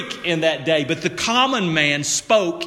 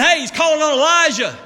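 higher, fast excited call; excited bystander; 8 semitones higher, faster than usual. Retold or imitated. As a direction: blurt it out to the crowd. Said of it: "they were saying".

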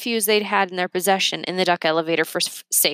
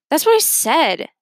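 serious; 9 semitones higher, 5 dB louder than usual. Acted. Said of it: "Remy concluded seriously".

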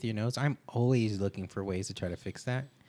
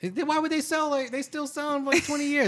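incredulous; 14 semitones higher, 5 dB louder than usual. Real, not acted. Insisting the strips work.

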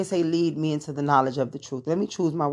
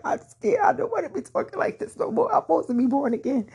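high, cracking, tearful; retold tearful plea; 13 semitones higher, faster than usual. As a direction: plead as if near tears, pitched way up. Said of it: "I mean, I was in tears".

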